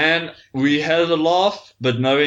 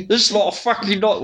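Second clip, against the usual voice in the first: usual speaking voice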